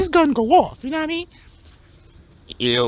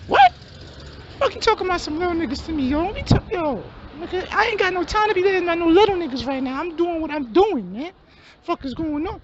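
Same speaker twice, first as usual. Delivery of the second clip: hustler friend; 2 semitones higher than usual. Acted. High-voiced hustler dismisses him.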